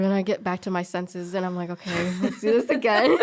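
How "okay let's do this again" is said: laughing, pumped-up resolve. Real, not acted. Pulling herself back together at work, laughing. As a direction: laugh through a pep talk to herself.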